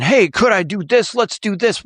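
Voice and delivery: high-pitched, eager pressing; pushy. Acted. Salesman pressing an owner for a yes, pushy. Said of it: "Being pushy kills deals".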